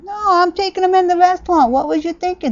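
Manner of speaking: high, loud indignant comeback; mock-outraged refusal; indignant retort. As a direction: argue back, pitch shooting up. Acted argument over the dog.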